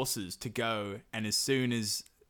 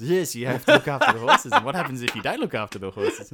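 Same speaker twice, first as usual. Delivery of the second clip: much louder, higher, laughing schoolteacher tone; patronising drill; 4 semitones higher, 11 dB louder, faster than usual. Pretend. Baba Yaga drilling the dim prince, loud, high and laughing.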